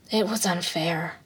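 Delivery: soft, slow and drawn out; inward thought. A narrator's reading. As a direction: say it quietly to yourself. Character's inward thought, spoken low.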